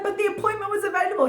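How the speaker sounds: high, quick protest; indignant retold squeal; baffled protest. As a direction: protest it fast and high-pitched. Acts out protesting to head.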